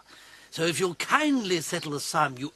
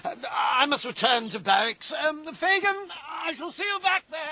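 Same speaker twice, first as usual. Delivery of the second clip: flustered retreat; 8 semitones higher than usual. Acted. Cowardly captain hurries away.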